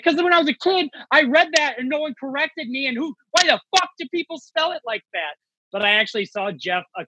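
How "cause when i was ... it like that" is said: high, worked-up protest; exasperated defense. Retold or imitated. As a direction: plead your case, all wound up. Defending himself to comics.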